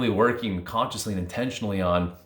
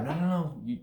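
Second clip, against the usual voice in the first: insistent inner voice; 7 semitones higher, 4 dB quieter than usual. Acted. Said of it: "And then it gets a little louder".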